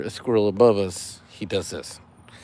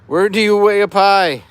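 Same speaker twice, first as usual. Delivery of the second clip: higher, louder corny riddle; dad joke voice; 8 semitones higher, 9 dB louder than usual. Acted. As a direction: pose the riddle like a proud dad. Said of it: "I'm doing it in dad joke voice".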